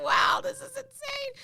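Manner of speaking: high, giddy exclamation of disbelief; starstruck excitement. Retold or imitated. Retelling meeting her idol on set, high and giddy. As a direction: squeal it like you can't believe your luck. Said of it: "I was doodle bobbing 'cause I was like".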